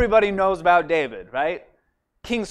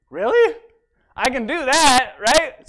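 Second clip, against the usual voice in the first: much higher and louder, thrilled disbelief; eager surprise; 7 semitones higher, 8 dB louder than usual. Retold or imitated. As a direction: jump at the chance, delighted. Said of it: "And David's like".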